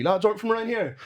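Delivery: mock a Polish lad's thick accent. Polish gym lad challenging the locals, in a mock accent. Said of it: "the Polish lad going in the gym being like".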